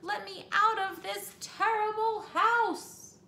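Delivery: high, loud, slow panicked wail; shrieking. Acted. Missus Hipple fleeing the house, loud high shriek. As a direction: drag out a frantic wail. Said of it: "Missus Hipple shrieked on".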